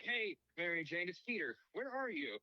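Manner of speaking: high-pitched, dorky message voice; mock a dumb, awkward phone message; dorky voicemail. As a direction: pitch it up like a hapless dork. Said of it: "Just super dumb and dorky".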